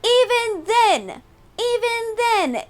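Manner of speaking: high, slow, drawn-out outrage; emphatic disbelief. Real, not acted. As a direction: stretch out each even then in disbelief. Exasperated retelling of game glitch.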